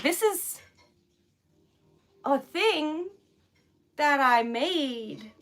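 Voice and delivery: halting mock presentation, starting high; stilted self-imitation. Mocking her filtered speech on stream, stilted and halting. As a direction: break it up with long, careful pauses.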